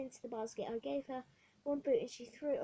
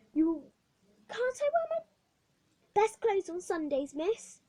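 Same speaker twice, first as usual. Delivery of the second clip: put-on much higher, hopeful child's question; girl asking the matron; pleading girl; 8 semitones higher, 9 dB louder than usual. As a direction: ask hopefully. Hetty asks about her clothes.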